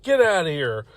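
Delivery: usual speaking voice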